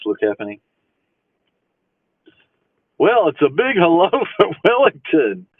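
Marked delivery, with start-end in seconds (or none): 3.0-5.5 high, swooping show-off greeting; hammed-up big hello. Streamer greeting viewers from Wellington, hammed-up big hello. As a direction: send out a big, higher-pitched hello.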